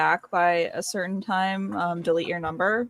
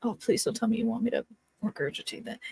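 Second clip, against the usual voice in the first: usual speaking voice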